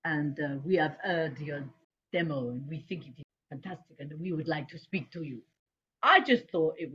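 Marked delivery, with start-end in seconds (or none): 0.0-5.4 put-on measured French accent, pitched down. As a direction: imitate a Frenchman leaving a formal message. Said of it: "this guy with a French accent".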